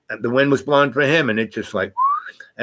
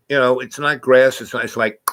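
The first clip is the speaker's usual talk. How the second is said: usual speaking voice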